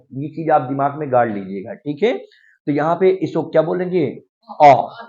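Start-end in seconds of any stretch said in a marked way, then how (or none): 4.6-5.0 "अ" for "or", a loud open aw without r; silent r. Shown as a demonstration. Teacher answering the quiz, "अ" with silent r. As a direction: call out "अ" with no r at the end. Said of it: "silent होता है".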